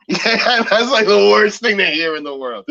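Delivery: high, loud laughing talk. Real, not acted. Host cracking up at the movie scene, laughing. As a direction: laugh through the words.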